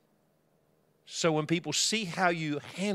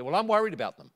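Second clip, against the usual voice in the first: higher, louder worried retort; raised, emphatic comeback; agitated insistence; 5 semitones higher, 6 dB louder, faster than usual. Retold or imitated. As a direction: imitate an upset neighbour insisting back. Neighbour's agitated insistence in reply.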